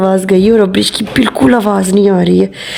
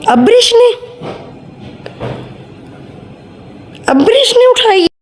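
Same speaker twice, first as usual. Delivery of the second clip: high, astonished repeat of the accusation; shocked disbelief; 13 semitones higher than usual. Pretend. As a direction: pitch jumps way up on Abrish, mock outrage. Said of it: "झूठी मूठी की call".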